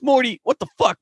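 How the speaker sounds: very high exasperated outburst; acted outrage. Rick scolding Morty over the monument, acted outrage. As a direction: let the pitch leap up in disbelief.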